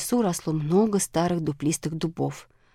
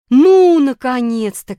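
loud high cry of relief; excited shout; 6 semitones higher, 10 dB louder, slower than usual. A narrator's reading. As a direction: call out high and loud, falling on the last word. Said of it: "кричала она".

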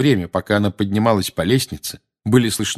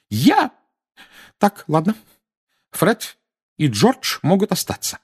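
mother flustered, voice up; 11 semitones higher than usual. Acted. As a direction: give in hotly. Mrs Weasley gives in.

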